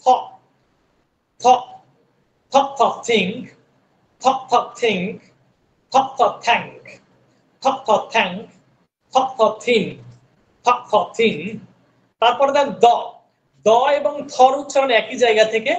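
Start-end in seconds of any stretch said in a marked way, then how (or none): 2.5-11.4 থিং with tongue between the teeth; th sound. Shown as a demonstration. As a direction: push the tongue out for the th. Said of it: "জিহ্বার মাথাটা দুইটা দাঁতের মাঝখান থেকে".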